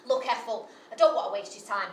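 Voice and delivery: ordinary talk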